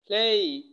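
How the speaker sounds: প্লে said loud and drawn out with a full "ey" ending; teacher's emphatic, drawn-out প্লে; pronunciation demo. Shown as a demonstration. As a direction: stretch the ending of প্লে into a clear "ay". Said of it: "এখানে একটা প্রনাউন্সিয়েশনের বিষয় আছে".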